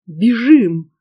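mildly moaned urgent plea; a touch of a panicked groan; moaning. A narrator's reading. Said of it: "простонал Риоль".

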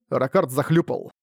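usual speaking voice

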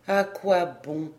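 slow, resigned question; weary. A narrator's reading. Dejected patient answering the doctor, weary tone. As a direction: let it drag with mild weariness. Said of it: "fit-il d'un ton de lassitude".

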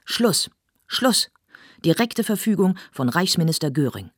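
loud, high shrill-free command; commanding shout. Acted. An official silences the quarrelling actors, loud and commanding. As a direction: shut them all up.

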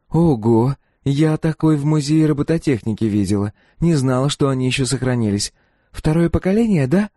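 put-on high-pitched young woman marvelling; impressed girl. A narrator's reading. Girl seeing the mercenary's old droid, impressed.

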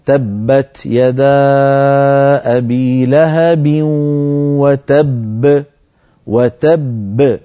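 تبت and وتب recited very slowly, the final باء released with a bounce; slow Quranic recitation with a clear echoing stop on the ب of وتب; tajweed demo of وتب with the second ب bouncing; qalqala on the doubled ba. Shown as a demonstration. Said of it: "هكذا".

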